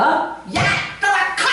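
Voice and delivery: high, sharp yelled order; battle shout. Shown as a demonstration. Preacher shows fighting off a demon, yelled command. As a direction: yell it to drive off an enemy. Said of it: "이렇게 되는 거지".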